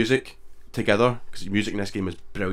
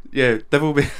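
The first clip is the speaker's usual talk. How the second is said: words squeezed out through laughter; trying to talk through giggles; laughing while talking; 5 semitones higher, 7 dB louder than usual. Real, not acted. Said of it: "I can't stop laughing".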